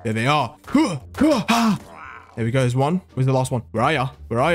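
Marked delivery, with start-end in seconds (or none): 0.7-1.8 high attack huffs; effort grunts. Real, not acted. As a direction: yelp with each strike. Attack noises during game fight.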